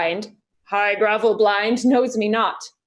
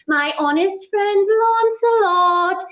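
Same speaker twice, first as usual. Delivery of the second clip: higher, slow sing-song; lightly voiced conscience, slow and higher; 7 semitones higher, slower than usual. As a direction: croon it like a wise conscience. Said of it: "says very wisely to me".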